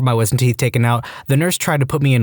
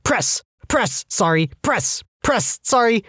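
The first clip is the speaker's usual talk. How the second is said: high, strained calls of press and sorry, pitched way up; frantic apologetic calls; 10 semitones higher, slower than usual. Retold or imitated. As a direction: blurt each word out in a panicky rhythm while pumping. Frantic CPR on conscious man.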